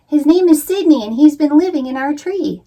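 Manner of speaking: shouting. Acted. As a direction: stop mom with a shout. Jamie pleading with mom.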